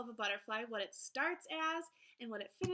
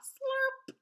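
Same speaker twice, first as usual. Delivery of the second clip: loud high slurping sound; 13 semitones higher, 7 dB louder than usual. Pretend. Teacher reading to kids, playful slurping noise. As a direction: make a big mock slurp like sipping through a straw. Reading a butterfly book aloud.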